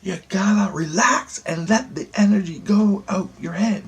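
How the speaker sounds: high-pitched mock yell; imitated yelling. She yells at him to relax, imitated high yell. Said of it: "she yells. It's so loud".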